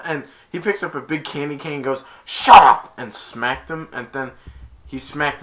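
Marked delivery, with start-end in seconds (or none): 2.2-2.9 very loud mock strike cry; attack yell. Man swinging the big candy cane, loud attack yell. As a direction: burst out with the blow.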